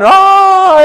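very high, very loud panicked scream. Retold or imitated. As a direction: yell as the claws dig in. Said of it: "I'm screaming and yelling".

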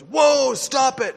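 high, loud startled cry; alarmed shout. Retold or imitated. Friend stopping the cursing man, loud alarmed call. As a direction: stop him mid-rant, sharply and loud. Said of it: "He goes".

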